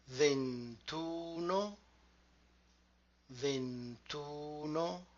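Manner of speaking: "ventuno" very slowly, each syllable drawn out. Shown as a demonstration. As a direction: softly stretch ven-tu-no for a learner. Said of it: "will break down".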